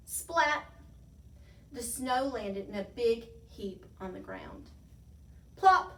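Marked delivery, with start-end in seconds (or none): 0.1-0.6 loud, high spoken splat. A narrator's reading. Narrator voicing snow hitting the ground, loud splat. Sound effect of falling snow. 5.6-5.9 loud, high spoken plop. A narrator's reading. Narrator voicing monster dropping into snow, loud plop. Sound effect of monster landing.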